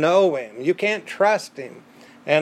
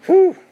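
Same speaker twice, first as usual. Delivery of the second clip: loud whew; 13 semitones higher, 8 dB louder than usual. Real, not acted. Preacher exhales after intense point.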